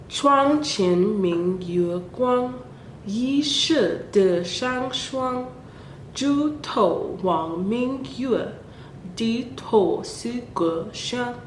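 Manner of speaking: slow, measured Mandarin verse; poem recitation in Mandarin. Acted. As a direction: recite each line slowly and carefully.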